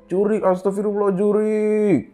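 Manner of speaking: very high-pitched mock scream; retold scream. Passing rider screaming toward the narrator, high mock yell. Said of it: "dia teriak ke arah aku".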